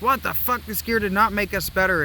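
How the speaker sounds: high, loud, disbelieving cry; shocked outburst. Retold or imitated. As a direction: squeal it like a betrayed kid. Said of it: "and we're like".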